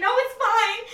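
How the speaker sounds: high, loud protest; teary insistence. Retold or imitated. Crying in her jumpsuit telling family to stay, high and loud. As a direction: wave them off loudly. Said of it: "I'm like".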